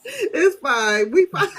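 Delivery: giggling through the words; laughing. Real, not acted. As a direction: laugh while reassuring. Laughing after fan mishap.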